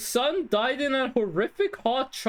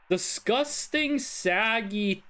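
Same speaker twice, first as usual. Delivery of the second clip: slow musing reread; slower than usual. Real, not acted. Rereads the caller's clue.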